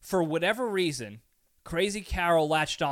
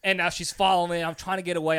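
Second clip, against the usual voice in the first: ordinary talk, nothing marked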